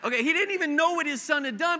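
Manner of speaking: loud, high, fast laughing restart; real amusement. Real, not acted. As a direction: pick the story back up through laughter. Restarts story after cracking himself up.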